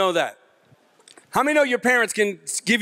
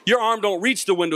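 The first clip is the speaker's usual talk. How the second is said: ordinary talk, nothing marked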